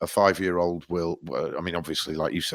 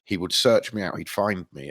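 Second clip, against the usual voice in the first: ordinary talk, nothing marked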